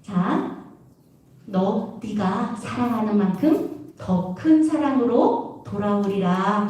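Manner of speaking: a hint of a solemn divine answer, slowly; voice of God. Teacher quoting God's reply to her prayer, low and slow. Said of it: "하나님 그런 말씀을 하시는 거예요".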